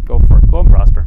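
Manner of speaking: loud, mocking "go for it"; sarcastic go-ahead. Micaiah mocking the king's prophets, loud and sarcastic. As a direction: toss it off sarcastically.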